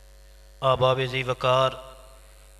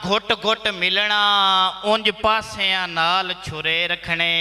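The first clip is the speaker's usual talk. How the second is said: higher-pitched, declaimed mushaira verse; poetry recital; 8 semitones higher, slower than usual. Acted. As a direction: declaim each line to the audience, pausing between phrases. (मुशायरे विच उच्ची सुर नाल शेर)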